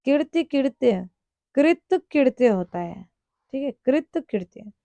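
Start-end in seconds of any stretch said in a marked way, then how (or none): none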